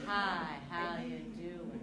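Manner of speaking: slow, low how you doing; slow pace demo. Shown as a demonstration. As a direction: drag the greeting out. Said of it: "The pace of our voice".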